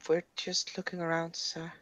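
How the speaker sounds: soft, polite, timid reply; quiet polite. Acted. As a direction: answer him softly and politely. Talking to stranger NPC.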